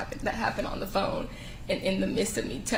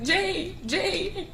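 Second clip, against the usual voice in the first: high, tearful calling of a name; tearful distress; 10 semitones higher, slower than usual. Real, not acted. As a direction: break down crying while recalling it. Witness relives calling her unresponsive friend.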